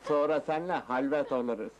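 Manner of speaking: ordinary talk, nothing marked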